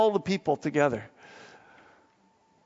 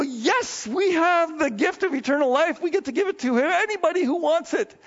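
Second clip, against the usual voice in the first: much higher, loud thrilled announcement; joyful eagerness; 12 semitones higher, 3 dB louder than usual. Acted. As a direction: burst with joy about sharing good news. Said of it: "We're supposed to go".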